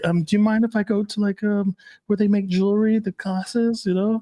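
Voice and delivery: put-on high-pitched, hesitant girlfriend voice; imitated submissive girlfriend. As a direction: imitate her timidly asking permission. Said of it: "she's like".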